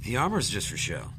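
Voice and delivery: normal talking voice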